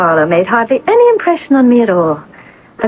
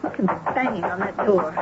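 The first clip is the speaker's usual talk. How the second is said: normal talking voice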